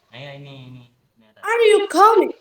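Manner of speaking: slow teaching demo, pitch climbing through "coming"; rising intonation. Shown as a demonstration. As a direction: say "are you coming" as a rising-tune question. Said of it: "rising intonation".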